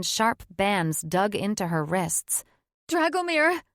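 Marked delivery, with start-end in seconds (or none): 2.9-3.6 a much higher cry for help. Acted. Captive woman calling to her mate, alarmed higher cry. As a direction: call him out in alarm. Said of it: "she cried".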